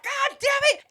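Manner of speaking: very high-pitched mock exasperation; exasperated exclamation. Keela realising who this is, high exasperated exclamation.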